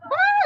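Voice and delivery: high, loud imitated tantrum cry; mock child's wail.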